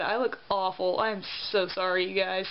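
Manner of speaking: normal talking voice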